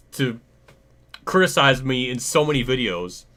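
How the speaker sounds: normal talking voice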